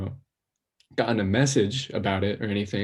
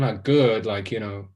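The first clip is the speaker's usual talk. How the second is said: usual speaking voice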